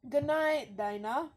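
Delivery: playful put-on British accent. Game master echoing a goodnight to Dana, British accent. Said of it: "I have to do a British accent".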